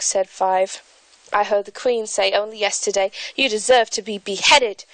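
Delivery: put-on a lightly done English accent, reading aloud; light British accent. YouTuber reads Alice aloud in a light British accent. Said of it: "I'm going to read a part from".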